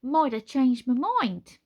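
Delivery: cheeky gargoyle voice. Acted. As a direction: toss it off airily. Kaz answering cheekily.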